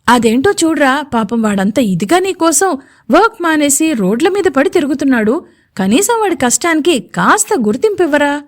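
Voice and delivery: ordinary delivery, nothing marked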